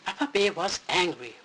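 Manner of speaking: ordinary delivery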